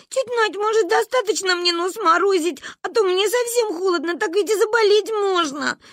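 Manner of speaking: put-on high, plaintive child's voice; whining little girl. A narrator's reading. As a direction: coax in a high, whiny plea. Girl begs mother to stop icing.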